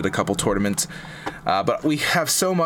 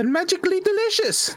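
much higher, sing-song Irish leprechaun lilt. Retold or imitated. Host praising Lucky Charms in honor of Saint Patrick's Day, Irish leprechaun voice. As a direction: chirp it like a cereal-box Irish leprechaun. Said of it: "That was my Irish voice, leprechaun voice".

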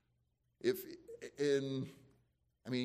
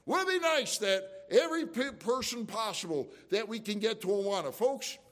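higher and louder impassioned preaching; on the soapbox; 8 semitones higher, 5 dB louder than usual. Real, not acted. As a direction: plead with the congregation from the pulpit. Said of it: "I don't get on my soapbox now".

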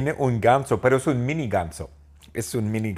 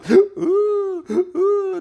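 a very high, loud crying gasp; hammed-up sob gasp; 5 dB louder than usual. Doctor Phil breaking down, loud high mock sob gasp.

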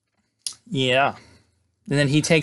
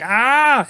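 mock yelp; 10 semitones higher, 4 dB louder than usual. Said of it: "and he's like".

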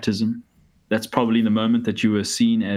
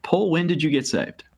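higher, lightly acted question; posed question; 6 semitones higher than usual. Pastor imagining asking the Apostle Paul, posed question. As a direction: put it to him directly, pitched up. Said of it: "if you had asked the Apostle Paul".

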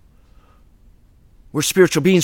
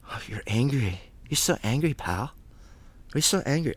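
much softer, lower taunting voice; mocking accuser; 3 semitones lower, 13 dB quieter than usual. Critics taunting the preacher about his anger, soft mocking. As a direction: needle him quietly like a smug critic. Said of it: "project their anger ... by saying".

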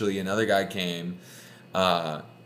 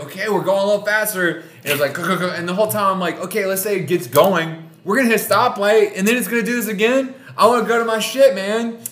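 exasperated; 10 semitones higher, 7 dB louder, faster than usual. Retold or imitated. Frustrated stuck in a dead taxi.

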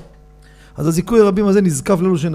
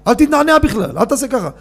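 mock stern rabbi; 7 semitones higher than usual. Rabbi warning worshippers not to sway, mock stern. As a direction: play the rabbi forbidding any sway. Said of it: "רבי בן ציון אומר".

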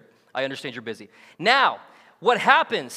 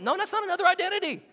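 playful high; 9 semitones higher, faster than usual. Retold or imitated.

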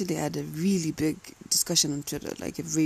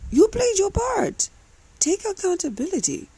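emphatic scolding; 14 semitones higher, 5 dB louder than usual. Real, not acted. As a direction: slam each word home like a verdict. Scolding the guilty friend.

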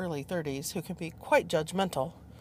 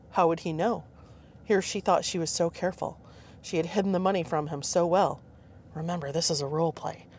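usual speaking voice

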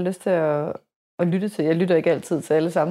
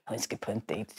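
mimicking the guys; 12 semitones lower, 16 dB quieter than usual. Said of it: "siger de så".

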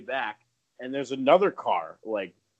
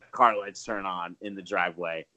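normal talking voice

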